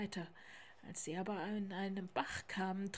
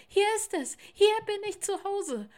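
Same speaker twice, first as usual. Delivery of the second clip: high, loud cry of joy; excited shout; 11 semitones higher, 12 dB louder than usual. A narrator's reading. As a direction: shout it as you leap off. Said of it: "Plötzlich sprang er ab und rief". (laut und freudig gerufen)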